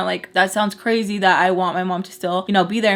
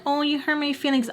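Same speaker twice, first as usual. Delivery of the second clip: small, high, mock-wounded voice; mock hurt; 6 semitones higher, 5 dB quieter than usual. Explaining why she cries, mock hurt. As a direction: whine it softly, making fun of hurt feelings. Mocking a hurt-feelings reason for crying.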